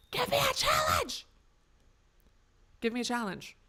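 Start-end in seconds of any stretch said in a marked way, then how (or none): 0.1-1.3 very high, loud cartoon yell; over-the-top mock demand, pitched way up; silly shouted demand. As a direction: shout it in a put-on squawk.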